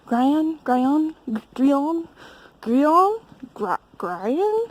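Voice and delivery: hesitant, questioning attempts at 'Grion', GRY-un then GREE-on; trying different pronunciations. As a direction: guess at 'Grion', vowels changing from GRY-un to GREE-on. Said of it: "six different pronunciations".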